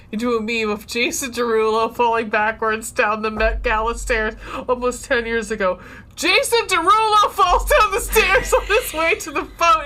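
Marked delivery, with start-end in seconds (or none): 6.2-9.8 high, louder headline shout; reading all caps. Acted. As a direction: read out as a raised mock yell. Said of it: "The reason I'm yelling that is because it's in all caps".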